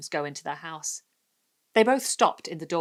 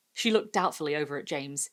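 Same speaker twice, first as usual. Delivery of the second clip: usual speaking voice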